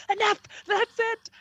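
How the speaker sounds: high, fed-up outburst; high squeaky done-with-this cry; mock exasperated cry. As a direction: throw up your hands and squeal it. [name] breaks up the fight.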